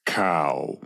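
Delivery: deep, low cow voice speaking. Acted. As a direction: play the talking cow low and slow. Improv cow answers in voice.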